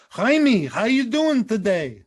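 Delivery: hammed-up Yiddish accent, drawn out. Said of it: "let me hear your Yiddish accent".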